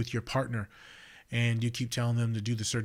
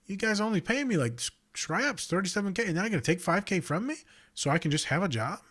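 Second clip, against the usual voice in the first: higher-pitched incredulous complaint; indignant outburst; 9 semitones higher than usual. Retold or imitated. Counselor protesting to new bosses, high and indignant. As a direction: protest the pay cut in disbelief.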